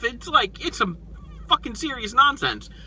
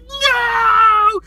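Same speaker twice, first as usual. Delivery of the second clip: long, high mock "no" wail; drawn-out imitated scream of denial; horrified cry; 13 semitones higher, 4 dB louder than usual. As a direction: cry out in hammed-up horror. Onlookers' cry at Han's death.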